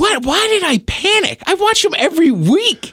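high, disbelieving self-scolding; incredulous at himself. Retold or imitated. Scolding himself after blanking at customs, high and incredulous. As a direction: squeak out your own disbelief at the slip.

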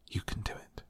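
a breathless whisper of encouragement; whispered to herself. A narrator's reading. Mary urging Colin on, to herself: whispered. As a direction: whisper "You can do it" under your breath. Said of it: "said Mary to herself".

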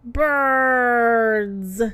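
very drawn-out single word; long, slow repeat of a new word. A narrator's reading. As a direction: stretch the word out, trying it for the first time. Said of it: "Bambi repeated".

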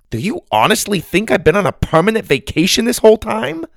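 high, quick disbelieving question; incredulous challenge. A narrator's reading. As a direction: confront him, astonished he could think it. Grandma confronts him, incredulous.

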